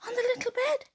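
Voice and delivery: ordinary delivery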